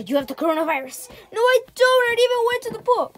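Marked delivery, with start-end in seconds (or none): none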